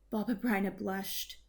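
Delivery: ordinary delivery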